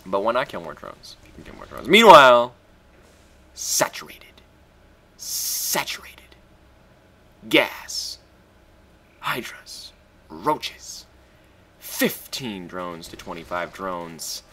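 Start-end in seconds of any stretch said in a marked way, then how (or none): 3.5-11.1 hushed whisper, slower at the end. Real, not acted. Gamer surveying enemy base, hushed whisper. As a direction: breathe the word like a secret.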